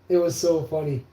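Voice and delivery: normal talking voice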